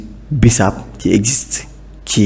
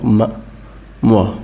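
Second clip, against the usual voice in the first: ordinary talk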